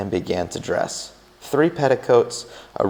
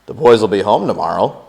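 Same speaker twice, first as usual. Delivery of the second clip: said again with more volume, to wake him; a little louder; 6 dB louder than usual. A narrator's reading. May repeating herself to her sleeping husband, louder. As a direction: raise the voice a notch over a sleeping man. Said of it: "a little more loudly".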